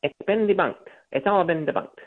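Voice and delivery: high, nasal mock of a smug little reporter. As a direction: mimic a wiry little reporter's nasal whine. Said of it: "with his little nasally voice".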